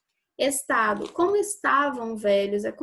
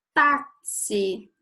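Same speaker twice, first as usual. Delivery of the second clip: "Táxi" with TÁ pushed out firmly; stress on the first syllable; 3 dB louder than usual. Shown as a demonstration. Teacher giving an example for pupils, TÁ stressed hard in "Táxi". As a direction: lean on the "Tá" of "Táxi". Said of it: "é o tá".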